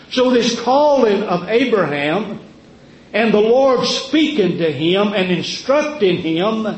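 ordinary talk

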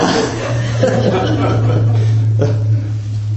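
breathy chuckle at his own joke; a real laugh at himself. Real, not acted. Laughing to the congregation at his own looks.

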